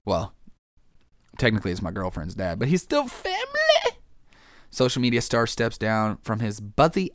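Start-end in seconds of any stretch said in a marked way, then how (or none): 2.6-4.0 squeaky joke voice. Acted. Joking about girlfriend's dad.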